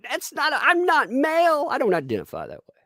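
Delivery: high, mocking voice for the witness. Streamer mocking the witness insisting they're not male, in a high mocking voice. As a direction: squeak it out like a mocked witness.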